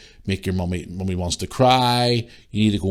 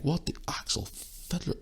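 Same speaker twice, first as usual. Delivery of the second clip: a breathy, drawn-out what the actual fiddler; exasperated disbelief; 7 semitones higher, 13 dB quieter than usual. Real, not acted. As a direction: say it as if you can't believe it.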